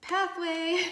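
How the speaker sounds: high, loud delighted exclamation; excited outburst. Real, not acted. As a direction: burst out with delight at the card. Card drawn, excited outburst.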